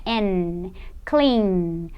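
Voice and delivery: low, lengthened "N", then "clean" held on its end; voiced final N. Shown as a demonstration. Teacher isolates the last sound of the verb, humming N in "clean". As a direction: let the N ring in "clean". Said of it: "Qual que é o último som".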